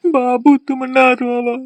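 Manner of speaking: slow, stretched whining plea; weepy consoling. Acted. Husband begs his crying wife to stop, weepy. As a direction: soothe her in a drawn-out, weepy whine.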